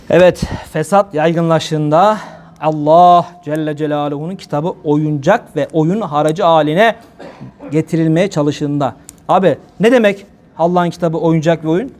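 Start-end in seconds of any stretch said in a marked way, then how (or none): 0.1-9.0 solemn preaching. Acted. Slow solemn preaching passage.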